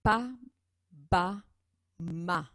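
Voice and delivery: teacher modelling "Pa, ba, ma" syllables; consonant demo. Shown as a demonstration. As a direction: model the lip sounds one by one, punchy. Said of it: "Je vous donne tout de suite un exemple".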